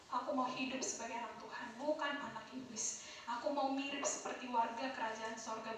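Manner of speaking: soft, low prayer-leading voice; hushed altar call. Acted. Preacher leading students in prayer, hushed and tender. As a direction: gently lead them to confess, very softly.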